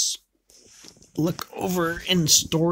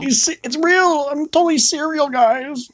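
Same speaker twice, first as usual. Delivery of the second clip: squeaky high cartoon voice, much higher; South Park impression; 13 semitones higher than usual. Parodying South Park to her partner in a high voice. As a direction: pitch way up like a cartoon character. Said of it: "I always think of South Park".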